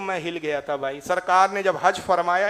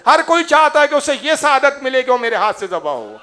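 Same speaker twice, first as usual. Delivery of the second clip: louder, higher preaching voice; fervent preaching; 4 semitones higher, 7 dB louder than usual. Real, not acted. Preacher stirs the listeners, loud and fervent. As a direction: drive it home to the congregation. Preacher rises to full force.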